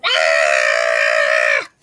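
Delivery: put-on open-mouthed kid's scream, loud and long; big scream. Boy screaming at the girl to get the book. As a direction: let out a huge wide-mouthed scream. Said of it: "yelled as loud as he could".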